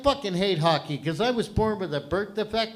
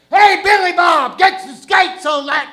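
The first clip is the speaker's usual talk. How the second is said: mock Texan yelling at Billy Bob; Texan accent; 8 semitones higher, 8 dB louder than usual. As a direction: holler it like a Texas rancher. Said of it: "that ain't the right accent".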